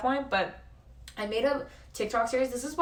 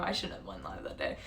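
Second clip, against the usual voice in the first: much lower, much softer regret; muttered aside; 13 semitones lower, 9 dB quieter than usual. Real, not acted. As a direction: mumble it under your breath. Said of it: "self-deprecating aside".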